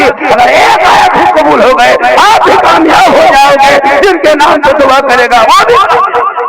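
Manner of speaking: loud, high, drawn-out sermon climax; impassioned preaching. Real, not acted. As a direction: build to a ringing, full-force promise. Preaches at full force.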